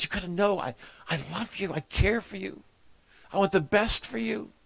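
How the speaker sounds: a touch of tears in the voice; tearful plea. Retold or imitated. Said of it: "begins, begins to cry".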